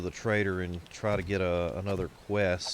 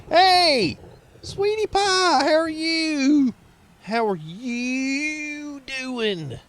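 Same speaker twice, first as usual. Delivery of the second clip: much higher, louder, slow sing-song hello; delighted greeting; 8 dB louder, much slower than usual. Real, not acted. Greeting a returning subscriber.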